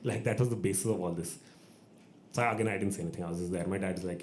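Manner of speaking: usual speaking voice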